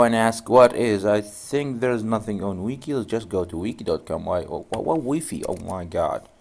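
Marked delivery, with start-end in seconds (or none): none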